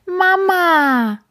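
high, loud joyful cry; overjoyed to see mother; happy kitten call. A narrator's reading. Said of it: "ruft der kleine schwarze Kater glücklich". (glücklicher, hoher Ruf)

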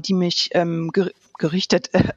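ordinary talk, nothing marked

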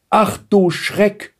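drawn-out, high-pitched startled cry; girl taken aback, slow and high. A narrator's reading. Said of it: "Blaustrümpfchen stotterte nur". (erschrocken, gedehnt und hoch)

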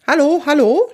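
high, loud testing hello; calling into microphone. A narrator's reading. Kunibert tests the radio microphone, calling high and loud. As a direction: call brightly up into a radio mic. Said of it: "spricht Kunibert jetzt in das Mikrofon".

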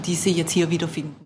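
usual speaking voice

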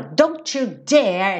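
slow threat with a long, heavy DARE; acted fury, drag out "dare"; menacing, drawn-out warning; really angry. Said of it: "Bem bravo".

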